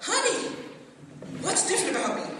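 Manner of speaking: high, sing-song mock wife voice; bubbly wife. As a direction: play the eager wife fishing for a compliment. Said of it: "she's like".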